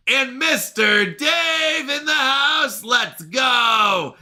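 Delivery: slow, high hype shout; excited shout. Real, not acted. Streamer welcoming Mister Dave to the house, excited shout. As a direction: stretch it out like an arena introduction. Greeting a viewer joining chat.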